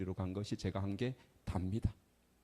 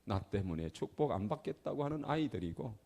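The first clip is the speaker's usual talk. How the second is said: ordinary delivery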